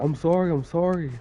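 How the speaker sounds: mock the boss's hasty sorry; high-pitched, placating mimicry; apologetic supervisor. Imitates supervisor's repeated apology.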